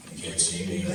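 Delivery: put-on whispered, soft, childish hiding; mock whisper. Drunk guy hiding from security, whispered. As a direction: hush it like he's invisible.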